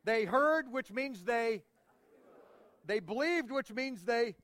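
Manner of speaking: energetic preacher leading the crowd; rousing call and response. Shown as a demonstration. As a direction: lift them into saying it together. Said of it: "Can you say it with me?".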